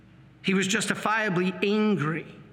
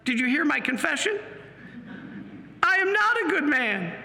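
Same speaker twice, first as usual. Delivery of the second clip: higher-pitched, incredulous objection; retold disbelief, voice rising high; acted protest; 7 semitones higher than usual. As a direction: insist against it, pitch jumping up. Acts out his disbelieving protest to priest.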